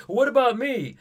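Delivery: high, loud sulky complaint; petulant whine. Retold or imitated. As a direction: whine like a left-out kid. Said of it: "he gets kind of petulant and sulky".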